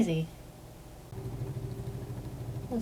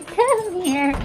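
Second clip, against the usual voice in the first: higher cooing pet voice; 8 semitones higher, 4 dB louder than usual. Real, not acted. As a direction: coax the dog over sweetly. Cooing at the dog.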